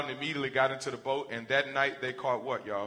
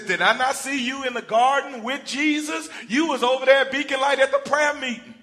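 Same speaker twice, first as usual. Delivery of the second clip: loud, high, laughing recognition; excited accuser; 12 semitones higher, 10 dB louder than usual. Acted. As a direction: catch him out gleefully.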